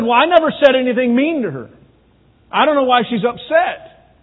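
high, loud, defensive husband; protesting husband. Retold or imitated. Husband in counseling denies being mean, defensive.

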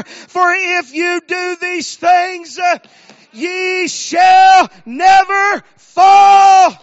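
high, shouted scripture proclamation; full-force preaching. Real, not acted. Preacher reading verse nine to the congregation, shouted preaching. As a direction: belt the verse out in preacher cadence, stretching the last words.

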